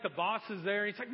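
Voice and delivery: ordinary talk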